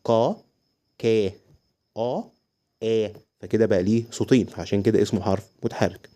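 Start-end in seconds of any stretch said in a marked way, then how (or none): 0.0-3.1 slow, separated syllables for learners; slow sounding-out. Shown as a demonstration. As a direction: draw each vowel sound out by itself. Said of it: "هننطق ببطء كده مع بعض". (نطق ببطء، مقطع مقطع)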